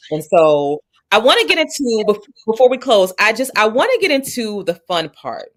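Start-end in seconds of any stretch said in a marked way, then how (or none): none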